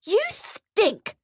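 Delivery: put-on very high child's outburst; high-pitched insult, drawn out; bratty shout. A narrator's reading. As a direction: fling it at him like an angry little girl. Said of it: "she shouts".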